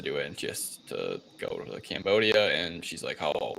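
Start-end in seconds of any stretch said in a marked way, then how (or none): none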